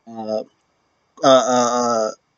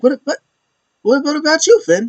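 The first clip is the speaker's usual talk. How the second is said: much higher mocking taunt; mocking question; 13 semitones higher than usual. Judgment Day member taunting Finn, high mocking voice. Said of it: "He's like".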